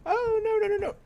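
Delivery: high panicked squeal of no. Real, not acted. Gamer sees his plan collapse, high panicked squeal. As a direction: shriek it as it all goes wrong. Panicked at game going wrong.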